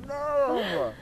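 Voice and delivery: high, loud shrieking laugh and no. Real, not acted. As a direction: squeal it out against the cold. Shrieking at the cold water.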